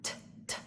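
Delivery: t, t as soft voiceless taps; whispered t sound. Shown as a demonstration. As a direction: tap out t, t softly. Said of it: "where you make the T sound".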